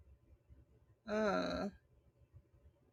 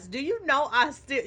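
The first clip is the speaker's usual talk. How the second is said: ordinary delivery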